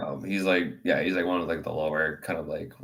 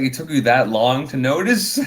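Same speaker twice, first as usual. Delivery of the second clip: higher, louder, slow dig; mock teasing retort; 5 semitones higher, 6 dB louder than usual. The gorilla teasing the slow customer, higher and slower. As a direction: drag the jab out.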